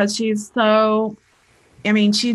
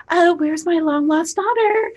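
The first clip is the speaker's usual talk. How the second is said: high, syrupy mock plea from mom; guilt-tripping mom; 8 semitones higher than usual. Daughter mimicking her mother's guilt trip, high and hammed-up. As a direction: ham up a needy mother's sigh. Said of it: "she uses emotions to manipulate".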